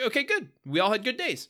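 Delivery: high, breezy brush-off; chirpy dismissive dad; chipper dad voice. Retold or imitated. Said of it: "Tim's like".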